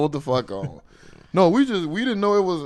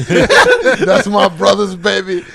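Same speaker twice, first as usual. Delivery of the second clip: slow, louder, laughing through it; laughing pride; 7 semitones higher, 11 dB louder, slower than usual. Real, not acted. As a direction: laugh out proud words. Laughing, proud of his nephew.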